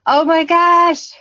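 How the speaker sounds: real, drawn-out awed exclamation; touched gasp. Real, not acted. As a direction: let the comment hit you and gush. Moved by a viewer's comment.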